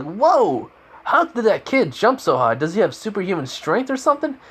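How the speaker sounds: high, amazed exclamation; high-pitched wow at a stunt; astonished. Acted. Astonished announcer reacts to jump.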